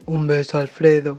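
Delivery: low deep sulphur-gas voice. Pretend. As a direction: drop way down for the sign-off. Same sign-off in deep voice.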